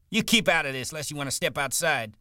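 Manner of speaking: high, heated challenge; hot-headed threat; angry threat. Acted. Curly turns on Carlson, threatening.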